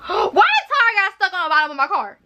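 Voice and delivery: shocked laughing question about the tire; gasping disbelief. Real, not acted. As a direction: gasp and laugh at how absurd it is.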